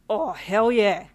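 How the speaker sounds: excited yell. Acted. Boyfriend cheering her acceptance, loud slow whoop. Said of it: "he yelled with excitement".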